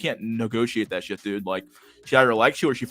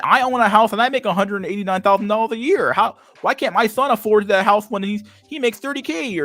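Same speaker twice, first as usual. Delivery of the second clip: much higher, louder whiny mock voice; mock boomer lady; 11 semitones higher, 6 dB louder than usual. Streamer mocking a boomer lady on rent, high whiny voice. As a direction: whine it like an indignant old lady.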